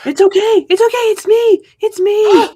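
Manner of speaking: high, loud pleading reassurance; a desperate sister insisting it's her; reassuring sister. Acted. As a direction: try to calm her down, fast and urgent. Comforting the terrified sister.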